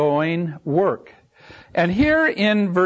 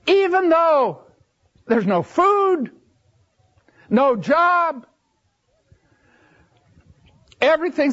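rising sermon lament; 8 semitones higher than usual. Acted. Preacher to congregation, listing life's troubles in a lifted sermon voice. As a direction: pile up the troubles from the pulpit.

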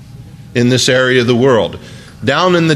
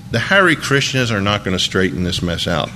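normal talking voice

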